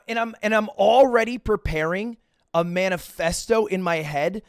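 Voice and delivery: usual speaking voice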